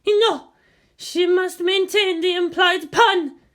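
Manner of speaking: high, loud, echoing outburst; echoing cry. A narrator's reading. Possessed Hasya crying out, high echoing shout. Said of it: "Her voice echoed".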